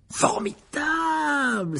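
very high, long drawn-out cheer; exclaiming. A narrator's reading. Fred and George envy Harry's adventure, exclaiming. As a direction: stretch the word out in delighted admiration. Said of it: "s'exclamèrent Fred et George d'une même voix".

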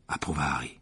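ordinary delivery

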